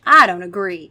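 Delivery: loud, firm disagreement; emphatic sharp retort; sharp reply. A narrator's reading. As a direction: cut in sharply. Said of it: "Kara replied sharply".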